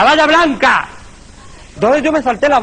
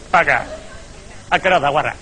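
muttered insult; 10 semitones lower, 6 dB quieter than usual. Acted.

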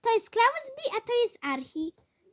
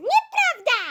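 loud, higher nieprawda; louder childish retort; 7 semitones higher, 7 dB louder than usual. Acted. Sister bickers back, louder and higher. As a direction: snap back at your sister.

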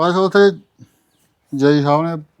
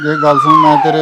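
husband bellows to shut her up, much higher and louder; a sudden drunken outburst; drunken yell; 6 dB louder than usual. Acted. Drunk husband yells back. (ਸ਼ਰਾਬੀ ਪਤੀ ਘਰਵਾਲੀ ਨੂੰ ਚੁੱਪ ਕਰਾਉਣ ਲਈ ਉੱਚੀ ਕੂਕਦਾ)

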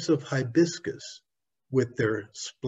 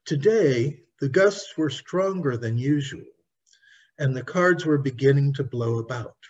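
ordinary delivery, nothing marked

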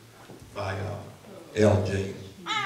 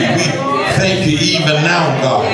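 louder, rising, shouted praise; fervent preaching prayer; 7 semitones higher, 11 dB louder than usual. Acted. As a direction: build the prayer into a full church shout.

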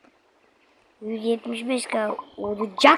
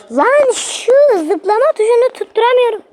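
high, loud real outburst; frustrated yell; 12 semitones higher, 8 dB louder than usual. Real, not acted. Gamer raging at a missed jump button, frustrated.

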